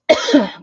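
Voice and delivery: sudden harsh cough; coughing on dust. Real, not acted. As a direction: cough from breathing in sawdust. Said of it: "I think I just breathed in some sawdust".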